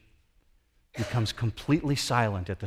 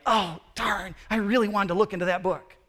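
higher and fast, a petty mock disappointment; mock wimpy whine; 8 semitones higher, faster than usual. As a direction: make fun of a shallow little complaint. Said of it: "it's not a simple wimpy cry".

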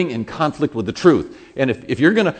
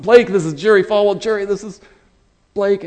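loud, higher-pitched mock introduction; hearty introduction; 8 semitones higher, 7 dB louder than usual. Friend introduces me to his famous friend, loud hearty gusto. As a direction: present two people with big gusto.

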